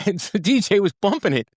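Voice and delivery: usual speaking voice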